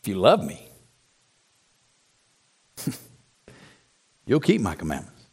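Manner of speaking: very low, soft and unforced, with long pauses; gentle, loving emphasis. Retold or imitated. Preacher showing what Jesus really said, gently. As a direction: let "love me" carry it and drop "keep my commandments" gently. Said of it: "Here's what he said".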